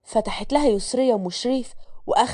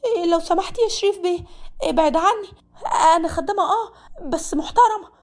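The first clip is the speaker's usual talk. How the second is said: pleading maid; 6 semitones higher than usual. Acted. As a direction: push him away, proud but scared. Malak pushes him away, shouting. (ملك بتصرخ في شريف إنه يبعد عنها، بخوف وكرامة)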